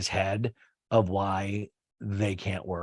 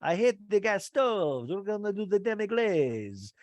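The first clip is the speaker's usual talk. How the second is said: high, hammed-up French accent with loud oui; mock French chef, pitched way up; playful French chef impression; 9 semitones higher, 4 dB louder than usual. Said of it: "he's talking French".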